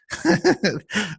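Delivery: laughing. Real, not acted. Laughs at own accent.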